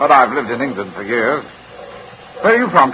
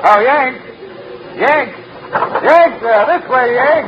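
calling out; 11 semitones higher than usual. Acted. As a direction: call out to him, waving him over. Calling to American on platform.

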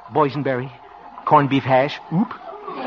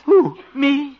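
surprised; 13 semitones higher, 6 dB louder than usual. Acted. Caught eating pie, denies.

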